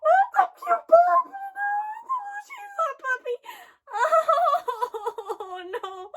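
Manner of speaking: high, tearful cry of protest; distressed wail. Real, not acted. Viewer upset over the cute puppy, distressed wail. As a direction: wail it, breaking into sobbing.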